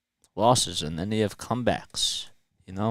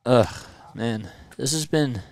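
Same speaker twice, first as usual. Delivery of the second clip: ordinary delivery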